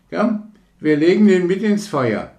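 hoch und laut gerufen; eifriger Junge. Acted. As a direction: ruf es eifrig dem Freund zu. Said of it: "sagte der andere Junge". (Junge ruft laut dem anderen Jungen zu)

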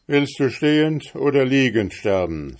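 a cruel innkeeper taunting his victim; menacing innkeeper. A narrator's reading. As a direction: savour the threat of the butcher's hall. Innkeeper asks with raised axe.

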